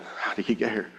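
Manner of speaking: very soft muttered question; baffled aside. Retold or imitated. Kneeling believer startled by pastor's arrival, baffled mutter. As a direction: wonder under your breath.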